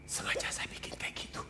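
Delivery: ominous whisper of a warning; God's chilling whisper to the preacher; eerie whisper. Acted. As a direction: whisper it like a threat. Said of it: "Serem nggak?".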